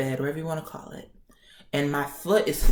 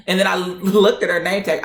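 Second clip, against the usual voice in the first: ordinary talk, nothing marked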